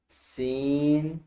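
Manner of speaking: सीन stretched very slowly and loud; drawn-out loud call. Shown as a demonstration. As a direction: hold सीन long for the class. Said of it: "जोर से बोलें". (ज़ोर से खींचकर सीन)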